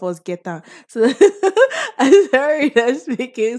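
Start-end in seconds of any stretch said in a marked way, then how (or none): 1.6-3.6 high laughing apology; giggling sorry for speaking so much pidgin; real laugh. Real, not acted. Laughs at how much pidgin she uses.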